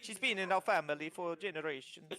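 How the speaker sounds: lightly voiced kobold, a touch sad over the horse; fussy little trader lamenting his loss; mildly grieving kobold merchant. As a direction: kobold with a hint of Italian lilt, mildly sorrowful. Kobold mourns horse, fears papa.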